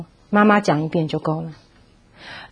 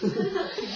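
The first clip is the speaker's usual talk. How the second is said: light laugh; 10 semitones higher, 12 dB quieter than usual. Real, not acted. Laughs at her own retort.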